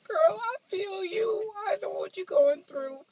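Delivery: high, loud, hammed-up crying voice; mock sobbing falsetto. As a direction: wail it like a melodramatic fan sobbing at a movie. Mock sobbing along with movie.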